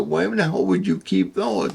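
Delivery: high-pitched, appalled disbelief; incredulous question. Retold or imitated. Visitor asking her about rats and mice, incredulous.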